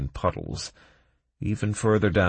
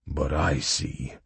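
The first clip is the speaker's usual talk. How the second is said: hoarse, slow dwarf voice; 2 semitones lower, slower than usual. A narrator's reading. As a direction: rasp it out slowly. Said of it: "hoarsely said Cray".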